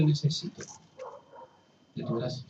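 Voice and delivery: pide la gracia casi en susurro, apagándose; súplica suave. Acted. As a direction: ruega quedito, rendido. Súplica del pecador a Dios. (el pecador ruega a Dios, voz muy baja)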